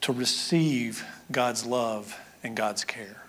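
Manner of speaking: hushed whisper, slow; whispered to the congregation in awe. Acted. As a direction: breathe the question softly. Whispered rhetorical question.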